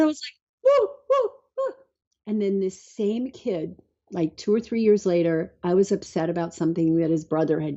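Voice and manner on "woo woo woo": high-pitched little "woo" sounds, imitated; amazed high whoops. Mom stunned that her kid said okay, amazed high whoops. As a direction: retell astonishment in short high hoots.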